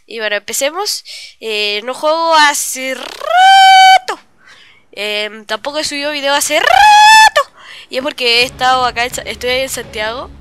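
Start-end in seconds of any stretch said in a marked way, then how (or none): 2.3-4.2 loud, drawn-out, higher final word; exaggerated emphasis. Real, not acted. Exaggerates how long since last playing. 6.3-7.4 long, loud cry on the last word; exaggerated emphasis. Real, not acted. Same exaggeration, about uploading videos.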